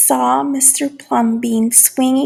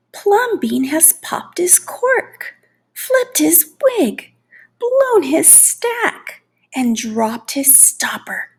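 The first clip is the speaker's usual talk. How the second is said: high, slow, scandalized half-whisper; gossiping neighbors; 8 semitones higher than usual. Acted. People gossip he's crazy.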